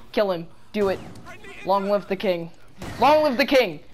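ordinary talk

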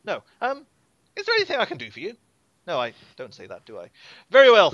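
put-on a boy-lord worried he seems rude; young duke dismisses the party, fussing over manners; fussy young duke.

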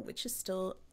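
secretive half-whispered aside. Real, not acted. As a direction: drop to a breathy whisper as if sharing a secret. Aside about being on year two.

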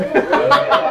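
short burst of audience laughter; crowd laughter. Real, not acted. Crowd laughs after joke.

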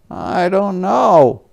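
a mild cry of helplessness; Jeffrey crying out at the cameras. Acted. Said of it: "cried Jeffrey".

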